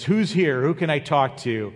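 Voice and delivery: loud, high-pitched call. Retold or imitated. Acted king calls out for someone.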